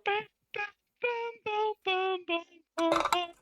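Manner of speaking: very high rhythmic bams; rapid-fire high cartoon hit sounds; imitated punch noises.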